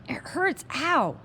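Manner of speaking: high pained whimper; whining in pain. Acted. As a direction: cry out that it hurts. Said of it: "Patrick kept yelling".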